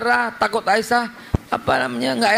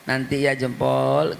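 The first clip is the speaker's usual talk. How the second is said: put-on lower, softer, slow cooing to a child; soft, slow, lower parent's coaxing; tender parent; 8 semitones lower, 3 dB quieter, slower than usual. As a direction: soothe the child gently.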